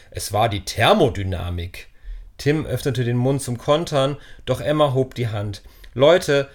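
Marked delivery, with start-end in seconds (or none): 0.1-1.9 teasing imitation of a nerdy friend; mock Tim impression. Ben mocks Tim's excuse. 5.9-6.5 louder call for attention; firm louder call to the friends. Acted. Emma calls the group to attention.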